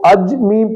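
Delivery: اجمی in two syllables, "aj-mi"; no vowel after the jeem in اجمی; غلط تلفظ. Shown as a demonstration. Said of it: "زبر کے بجائے جزم". (اجمی، جیم پر جزم کے ساتھ)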